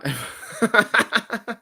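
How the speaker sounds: amused laugh. Real, not acted. Finds the podcast isn't real.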